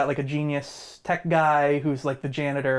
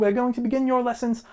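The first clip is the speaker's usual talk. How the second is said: hyper teacher; 7 semitones higher than usual. Retold or imitated. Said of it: "he's very hyper".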